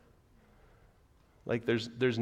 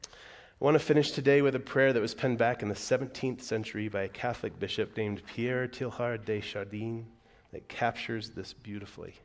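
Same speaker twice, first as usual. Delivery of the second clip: ordinary talk, nothing marked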